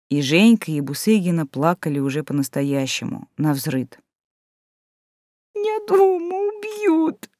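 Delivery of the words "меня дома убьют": high slow whimper. A narrator's reading. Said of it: "проскулила Лида".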